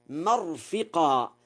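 مرفقا as "marfiqa"; fatha mim, kasra fa. Shown as a demonstration. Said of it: "بفتح الميم وكسر الفاء".

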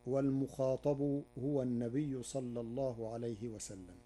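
ordinary talk, nothing marked

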